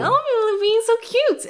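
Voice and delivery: very high cooing voice; mock cute voice. Mocking how the show frames characters, high cooing. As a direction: coo like the show wants you to. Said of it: "this is just like".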